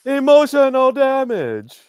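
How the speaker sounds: high, loud, drawn-out exclamation; big comic impression, slow and loud; mock catchphrase shout. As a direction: stretch it out in a hammed-up high cry. Mimicking the comedian's known catchphrase.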